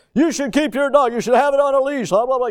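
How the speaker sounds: scolding neighbors. Retold or imitated. Neighbors telling off the dog's owner, loud scolding. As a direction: lay into the careless owner.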